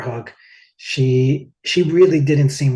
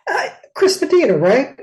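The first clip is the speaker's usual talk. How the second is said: mock-excited celeb voice, much higher; 13 semitones higher, 6 dB louder than usual. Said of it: "he turned around and he goes".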